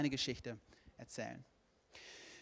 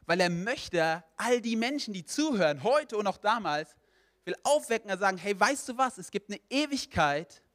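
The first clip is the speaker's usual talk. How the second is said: raised, higher pulpit voice; impassioned preaching; 4 semitones higher, 6 dB louder than usual. Real, not acted. Preacher tells the congregation God wants all saved, impassioned preaching. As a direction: press the message home with force.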